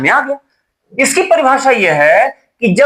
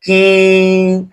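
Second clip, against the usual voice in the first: "कि" with the i sustained three beats or more; prolonged vowel. Shown as a demonstration. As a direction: draw "कि" out on one steady note. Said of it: "इससे तीन गुना या तीन से ज्यादा गुना time". ("कि" को तीन गुना से ज्यादा खींचकर)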